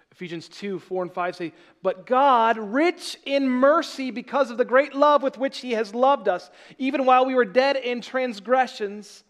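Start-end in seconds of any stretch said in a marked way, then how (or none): 1.8-9.2 raised, slow and loud scripture reading; full-force preaching. Acted. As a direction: proclaim the verse to the whole hall.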